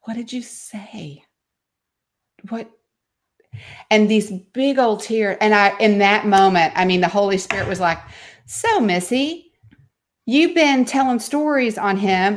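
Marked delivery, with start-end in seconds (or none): 0.0-2.7 soft, slow and wounded; hurt, quiet question. Retold or imitated. Young son asking his mom, hurt and soft. 8.4-12.4 a hint of a higher, slow, gently chiding voice; lightly voiced inner rebuke, slow; gentle inner voice. The Holy Spirit gently correcting Missy, slow and higher.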